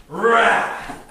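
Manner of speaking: loud, high burst of rage; mock enraged roar. Preacher showing flat-out wrath, a loud roar. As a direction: explode in an acted roar.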